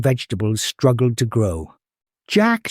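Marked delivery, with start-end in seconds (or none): 2.3-2.7 worried mother calling. Acted. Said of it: "her voice heavy with worry".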